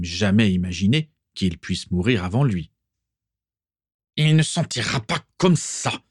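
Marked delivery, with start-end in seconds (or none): 4.2-6.0 higher, nasal, disagreeable grumble; angry father grumbling upstairs about his son, nasal; nasal muttering father. A narrator's reading. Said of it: "marmonna soudain la voix nasillarde".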